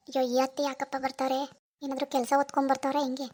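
usual speaking voice

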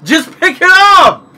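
high, loud, slow shout; yelling. Real, not acted. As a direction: yell it at the player. Yelling at player.

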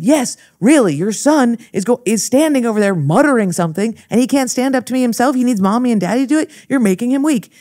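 mock yell at the parents, a bit fast; retold shouting. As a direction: hammer the parents, voice up high.

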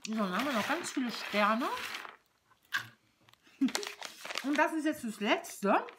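talking around a bite of chocolate; chewing while speaking, then a laugh; mouth full. Real, not acted. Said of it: "Mit vollem Mund spricht man nicht".

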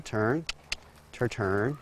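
murmured turning cue; soft cue. Real, not acted. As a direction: coax her round quietly. Cueing horse to keep turning.

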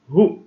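هو as a brief hu, no lengthening; short vowel. Shown as a demonstration. Teacher contrasting short and long sounds, very brief hu for هو. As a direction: say هو very short, just hu. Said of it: "القصير أقل من ثانية".

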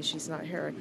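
ordinary talk

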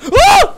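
sudden high, loud shriek; real jump-scare scream; startled scream. Real, not acted. Jump scare in horror game.

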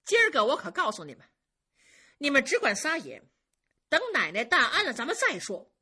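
loud, high, brisk warning to those outside; calling out a warning. A narrator's reading. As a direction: warn the crowd beyond the door. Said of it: "就冲门外头说".

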